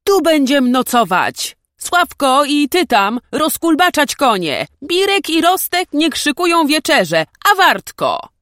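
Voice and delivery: high, loud hollering across a distance; young nobleman yelling orders; shouted orders. A narrator's reading. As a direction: shout commands to the wagons behind. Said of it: "krzyknął w stronę nadciągających wozów".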